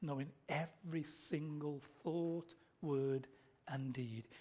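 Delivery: slow, softened, weighted list; hushed gravity. Real, not acted. Preacher telling congregation God knew their every sin, hushed and grave. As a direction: drop soft and space each word out.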